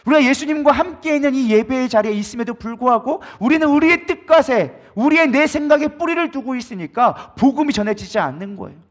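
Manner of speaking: usual speaking voice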